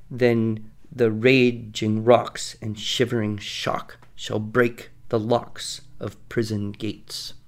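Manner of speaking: slow, steady beat on every second syllable; iambic reading. Shown as a demonstration. Teacher reciting a line for the host, slow and beat-driven. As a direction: tap out the weak-strong pulse as you read slowly. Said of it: "when you have an iambic pattern".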